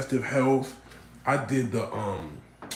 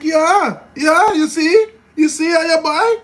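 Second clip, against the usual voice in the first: high, loud Chinese Jamaican insistence; Chinese Jamaican accent; 13 dB louder, slower than usual. Retold or imitated. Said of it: "it's literally Chinese Jamaican".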